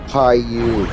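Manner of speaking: normal talking voice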